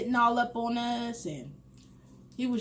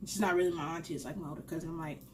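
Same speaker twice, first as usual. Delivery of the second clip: ordinary talk, nothing marked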